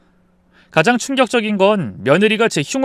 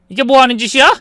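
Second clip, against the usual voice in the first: higher, louder indignant demand; angry confrontation; 8 semitones higher, 6 dB louder, faster than usual. Acted. Mother-in-law catching daughter-in-law with her diary, angry demand. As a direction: catch them red-handed.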